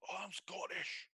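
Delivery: put-on Scottish accent. Player announces his Scottish character, in a slow Scottish accent. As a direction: roll into a jokey Scottish accent. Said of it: "please tell me you're not gonna actually do a Scottish accent".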